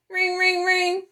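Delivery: high sing-song ring ring; imitating a fire alarm bell. Alarm bell sound voiced.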